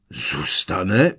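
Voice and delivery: incredulous echo. A narrator's reading. Strýc Vernon questions Petunie's decision, incredulous. Uncle echoes in disbelief.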